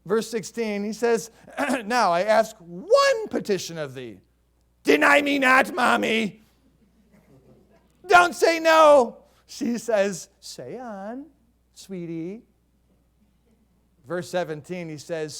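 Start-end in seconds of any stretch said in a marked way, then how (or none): 1.8-9.2 loud, high whine to his stepmother; whining to mommy. Acted. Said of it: "he's just whining". 10.4-12.4 soft, sweet motherly reply; tender mother. Acted. Bathsheba voiced tenderly.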